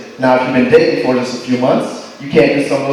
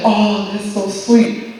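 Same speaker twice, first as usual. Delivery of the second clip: slow, soft, higher swoon; mock sweet girlfriend; 4 semitones higher, 4 dB quieter, much slower than usual. Mocking the girlfriend's sappy sweetness. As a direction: coo it like a lovesick girlfriend.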